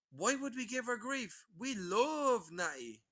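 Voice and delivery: slow, sing-song fake sweetness; mocking troll pleading sweetly; mock innocent troll. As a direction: play the sugary troll who claims love.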